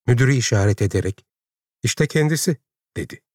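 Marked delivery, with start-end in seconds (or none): 1.8-2.6 slightly higher-pitched character voice; pointing him out. A narrator's reading. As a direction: present him to someone. Character announces the director to her. (işaret ederek tanıtır gibi)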